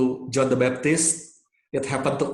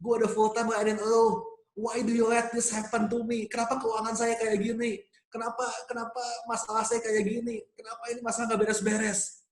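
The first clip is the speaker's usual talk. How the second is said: retold angry prayer; 7 semitones higher than usual. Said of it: "Saya marah-marah tuh, saya ngomel-ngomel".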